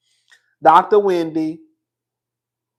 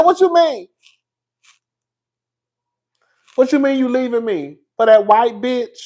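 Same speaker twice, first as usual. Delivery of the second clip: high, indignant demand; outraged wife; 5 semitones higher than usual. Retold or imitated. Wife confronting husband leaving her, high and outraged. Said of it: "Mary said".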